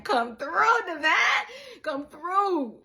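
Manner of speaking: high, loud cheer; hyped cheering. Retold or imitated. Vlogger cheering dancers on, hyped mock praise. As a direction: hype them up like church.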